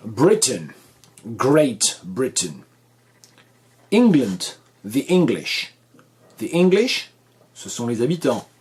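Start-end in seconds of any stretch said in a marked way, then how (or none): 0.1-2.5 Britain stressed on the first syllable, weak ending; slow, careful "Great Britain" in English; English pronunciation model. Shown as a demonstration. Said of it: "aidant à prononcer correctement". 3.9-7.1 England and English stressed firmly on the first syllable; careful, emphatic English model words; English pronunciation model. Shown as a demonstration. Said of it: "prononcer English".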